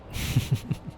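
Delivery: short, breathy run of chuckles; airy giggle after a tease; teasing chuckle. Acted. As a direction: break into a little laugh. Teasing the human, then laughs.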